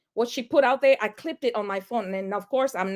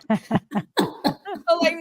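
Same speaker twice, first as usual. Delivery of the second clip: normal talking voice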